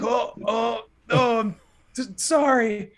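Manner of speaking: high stammered oh oh sorry; flustered apology. Retold or imitated. Young salesman apologising to farmer, high and flustered. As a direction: trip over the words, caught off guard. Speaker voicing his flustered self.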